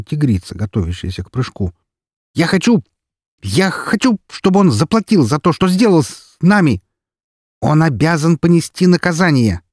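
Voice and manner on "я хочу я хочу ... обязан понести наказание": furious demand. Acted. Yudina demands punishment.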